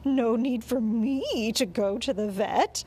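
slow, mildly whimpering protest. A narrator's reading. Dog dreading a trip to the vet, mildly whimpering. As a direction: plead slowly with a touch of whimper. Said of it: "I started to whimper".

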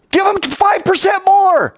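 high, loud, exasperated comeback; emphatic shout. Acted. Teacher answering a what-if, high emphatic shout. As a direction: fire the answer back at full voice.

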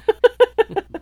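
high little giggle; a quick squeaky laugh; high-pitched laugh. Real, not acted. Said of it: "that little high-pitched noise there".

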